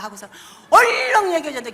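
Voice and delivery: ordinary talk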